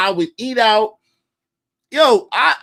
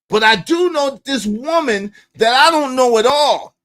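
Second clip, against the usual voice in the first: normal talking voice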